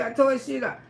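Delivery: ordinary talk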